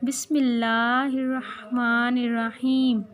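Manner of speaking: drawn-out, careful recitation of the Bismillah; slow it down so children can repeat; slow devout model recitation. Shown as a demonstration. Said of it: "क्या होता है?".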